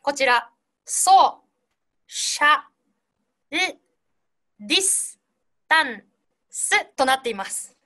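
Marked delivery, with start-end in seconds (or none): 0.9-6.9 "ソー シャ ル ディス タン ス" one piece at a time with pauses; split into syllables. Shown as a demonstration. Teacher showing her own division of the word, "ソー シャ ル ディス タン ス" piece by piece. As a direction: separate each chunk of "ソー シャ ル ディス タン ス". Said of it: "独自の分け方をしてみました".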